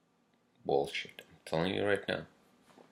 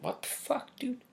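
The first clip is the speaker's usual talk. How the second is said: much higher, incredulous outburst; mock disbelief; 3 dB quieter than usual. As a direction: play your own stunned reaction, pitched way up. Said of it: "I'm like".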